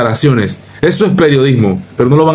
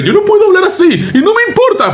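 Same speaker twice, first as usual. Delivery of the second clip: much higher laughing mock voice; laughing mockery. Youtuber laughing at a news clip, squealing mock voice. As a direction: squeal it through a laugh, mocking. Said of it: "con ese estilo".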